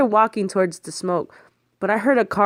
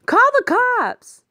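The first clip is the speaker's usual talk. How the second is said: very high, slow, loud alarm cry; panicked yell for the police; urgent shout; 4 dB louder, slower than usual. Acted. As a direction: scream for help. Urgent cry after theft.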